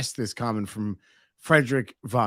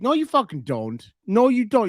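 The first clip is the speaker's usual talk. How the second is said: emphatic retort; 8 semitones higher than usual. Real, not acted. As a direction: shut him down with a sharp high no. Scolding friend, pitch jump.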